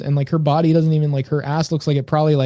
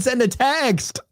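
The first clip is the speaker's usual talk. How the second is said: rapper impression; 9 semitones higher, much slower than usual. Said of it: "he said".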